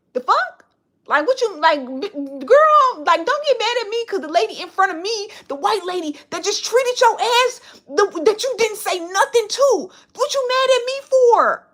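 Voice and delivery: high, loud, indignant retort; retold confrontation. Confronting the cashier who skipped her number, indignant and high. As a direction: call her out, affronted and high. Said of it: "I said".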